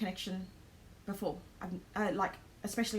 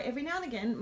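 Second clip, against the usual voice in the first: ordinary delivery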